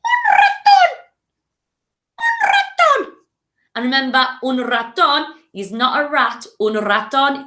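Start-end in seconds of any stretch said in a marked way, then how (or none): 0.0-0.9 very high, loud squeak; super squeaky and loud; squeaky mouse shout. Shown as a demonstration. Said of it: "Super squeaky voice". 2.2-3.1 very high, slow squeak with a rising end; super squeaky voice; squeaky mouse voice. Shown as a demonstration. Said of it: "Super squeaky voice".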